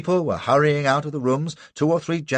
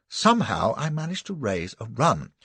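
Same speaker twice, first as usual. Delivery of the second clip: plain reading voice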